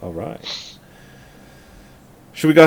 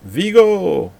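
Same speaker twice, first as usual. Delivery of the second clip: excited shout; 7 semitones higher than usual. Pretend. Excited cry of actor's name.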